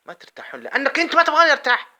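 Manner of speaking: high, loud and racing, furious at them; angry outburst. Real, not acted. Ranting at critics who won't let him rest, in a high, fast, loud outburst. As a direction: blurt it out fast in a burst of anger.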